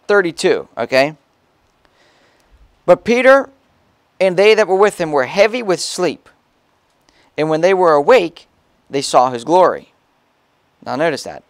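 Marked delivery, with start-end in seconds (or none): 2.9-9.9 loud, high preaching read; fervent pulpit reading; preaching scripture. Acted. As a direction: proclaim the verse to the congregation. Preacher reads scripture aloud.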